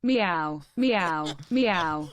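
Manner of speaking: text-to-speech reading meows; flat synthetic meows; robot voice. Acted. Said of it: "a robot talking".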